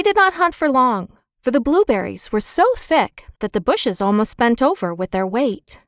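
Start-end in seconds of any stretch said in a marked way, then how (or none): none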